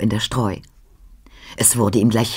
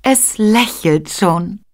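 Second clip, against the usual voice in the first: high, slow, enraptured Mary; blissful; 8 semitones higher, much slower than usual. A narrator's reading. Mary shows Joseph the child's smile, blissful. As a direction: savour each word in blissful wonder. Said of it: "sagte Maria selig".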